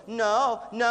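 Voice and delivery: mock warning from the inner voice; little inner voice. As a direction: voice a protesting conscience, loudly. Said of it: "Did that little voice just go".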